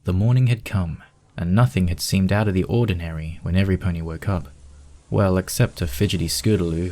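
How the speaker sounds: plain reading voice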